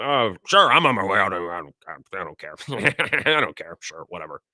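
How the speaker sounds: a hint of a bored exec with his mouth full; lazy shrug of a voice, half laughing; lightly voiced Mark Murphy. President brushing off the league over a sandwich, lightly mock indifferent. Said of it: "Mark Murphy's probably like".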